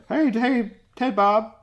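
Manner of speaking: high yokel holler. Pretend. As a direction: call out like a trailer park neighbor. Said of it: "sounds like he comes from a trailer park".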